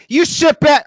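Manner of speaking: loud, high mock order; mock command. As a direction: bark it at the crowd playfully.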